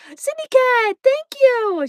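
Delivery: bright, high, loud gratitude; excited thanks. Real, not acted. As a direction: gush a big thank-you.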